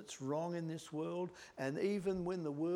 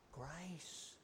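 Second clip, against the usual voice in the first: much softer, hushed single word; 3 semitones lower, 14 dB quieter than usual. Real, not acted. Preacher answering how to restore someone, softly. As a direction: breathe the word gently. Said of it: "answers softly with one word".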